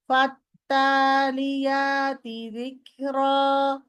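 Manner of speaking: slow drawn-out ফাত্তালিয়াতি যিকরা with the doubled ত held long; wrong shortened reading. Shown as a demonstration. Tajweed teacher showing students a wrong reading, slow and drawn out. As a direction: stretch ফাত্তালিয়াতি slowly, stressing the doubled ত. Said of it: "এরকম কেউ বলবো না আমরা".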